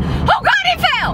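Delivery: very high shrieking alarm; mock panic. Wife imitating what husband imagined, high panicked shriek. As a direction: squeal like disaster struck. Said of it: "he thought I was going".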